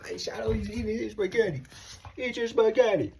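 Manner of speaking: put-on high, small child's voice; little brother's voice. Little brother sticking up for Daddy, in a high child's voice. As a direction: pipe up like a little kid defending Daddy. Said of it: "And his brother McCoy".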